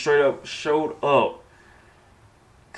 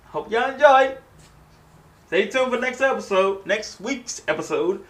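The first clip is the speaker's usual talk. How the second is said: chirpy sign-off; 8 semitones higher than usual. Real, not acted. Outro sign-off.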